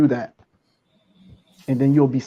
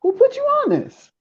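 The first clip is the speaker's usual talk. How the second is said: mock scolding parent. Parent scolding child, high voice.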